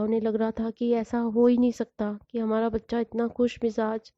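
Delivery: usual speaking voice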